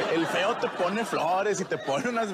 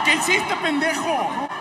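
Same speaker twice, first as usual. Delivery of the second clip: panicked self-rebuke; 10 semitones higher, 4 dB louder, slower than usual. Retold or imitated.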